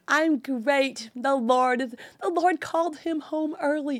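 high, sing-out fake positivity; sarcastically chirpy, high voice; mock pious cheer. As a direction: parody an over-bright believer putting a good face on loss. Mocking pious cheer she cannot say.

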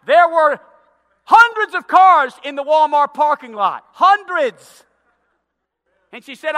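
loud, high, fervent sermon climax; full-force preaching. Acted. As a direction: build the story to a shouting peak.

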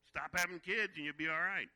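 loud mock advice to his dad; loud retort. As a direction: blurt it out like a cheeky kid. Retorts about dad's six kids.

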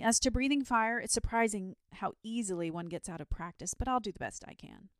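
confident. A narrator's reading. Dragon promising Saint George his best fire-breathing, confident. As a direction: breezily own up to being rusty. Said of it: "replied the dragon confidently".

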